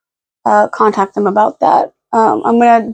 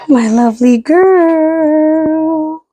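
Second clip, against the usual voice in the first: higher, slow drawn-out endearment; slow tender coo; 6 semitones higher, much slower than usual. Real, not acted. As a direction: dote on the dog. Cooing at the dog.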